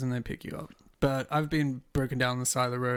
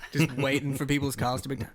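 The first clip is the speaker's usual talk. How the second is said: ordinary talk, nothing marked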